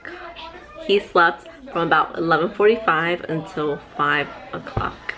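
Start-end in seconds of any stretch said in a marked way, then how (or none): 0.0-0.9 breathless whispered gosh; relieved whisper. Retold or imitated. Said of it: "I'm like".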